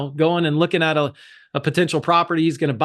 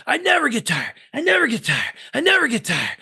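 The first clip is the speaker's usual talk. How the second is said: higher, repeated chant; pumped mantra; 7 semitones higher than usual. Retold or imitated. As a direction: chant it while lifting. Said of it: "And I just said".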